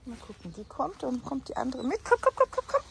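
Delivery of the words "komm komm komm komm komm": quick, high, loud repeated calls; animal call. Real, not acted. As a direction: coax an animal with rapid high chirps. Calling the escaped animal. (Tier hoch und hastig lockend)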